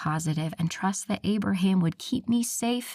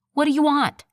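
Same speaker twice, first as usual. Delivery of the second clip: angry; 8 semitones higher, 7 dB louder than usual. Acted. Heroine snaps at coworker.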